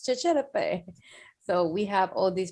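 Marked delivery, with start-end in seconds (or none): 0.0-0.8 higher mock-French catchphrase; imitated crowd catchphrase. Everybody repeating the professor's French phrase, pitched up. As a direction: lift the pitch to echo the professor. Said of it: "but everybody was".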